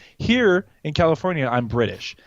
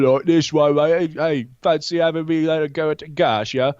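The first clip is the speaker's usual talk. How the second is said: slurred, grungy British chat-up; thick grungy British accent. Shown as a demonstration. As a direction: do a really grungy British lad. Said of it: "The really grungy one".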